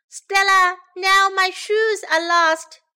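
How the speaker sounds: high, loud, slow complaint; drawn-out call for assistance. A narrator's reading. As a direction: wail it slowly to another room. Sam calls out again for help.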